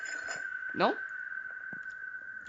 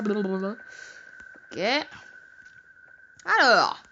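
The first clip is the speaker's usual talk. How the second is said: normal talking voice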